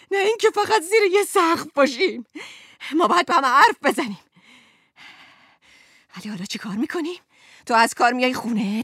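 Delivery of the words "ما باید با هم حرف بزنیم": louder, fast demand with حرف punched; loud insistence. Acted. Wife loudly demanding her husband talk, fast and forceful. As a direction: pound the word حرف home.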